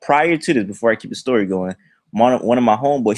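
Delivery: usual speaking voice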